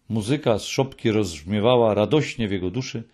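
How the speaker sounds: ordinary delivery, nothing marked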